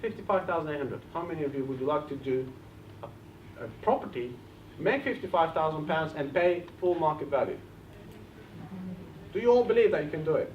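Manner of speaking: ordinary talk, nothing marked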